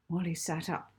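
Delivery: plain reading voice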